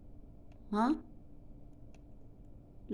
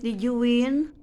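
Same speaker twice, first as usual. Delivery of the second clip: ordinary talk, nothing marked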